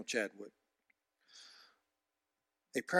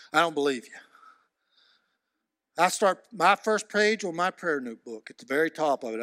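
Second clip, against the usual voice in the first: ordinary delivery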